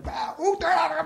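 high, hammed-up panicked yelps; mock panicked screaming. Passenger jokes the captain panicking over the power cut, mock screams. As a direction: pretend the captain is losing it. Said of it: "screaming at people 'cause the power's out".

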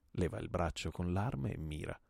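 ordinary delivery, nothing marked

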